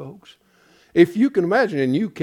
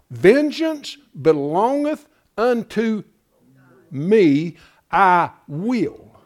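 slow, weighty proclamation; solemn scripture reading; 5 semitones higher, 5 dB louder, much slower than usual. Acted. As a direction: pronounce God's vengeance. Reads scripture aloud.

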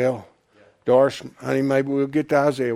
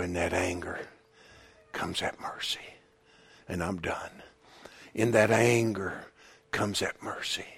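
low, very soft pulpit voice; quiet, grave sermon delivery; hushed preaching; 6 semitones lower, 10 dB quieter than usual. Real, not acted. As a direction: drop almost to a whisper for solemn weight. Hushed preaching after the climax.

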